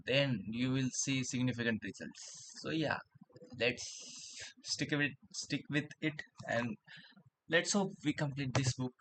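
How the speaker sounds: talking around a pen in the mouth; pen in teeth. Real, not acted. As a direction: speak with a pen clamped in the teeth. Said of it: "reading the book with pen in my mouth".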